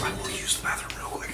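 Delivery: whispered aside; whispering. Real, not acted. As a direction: whisper it quickly. Stepping away, unvoiced.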